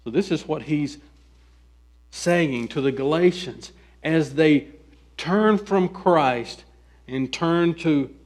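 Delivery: ordinary delivery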